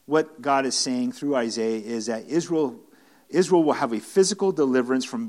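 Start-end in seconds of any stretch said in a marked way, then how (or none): none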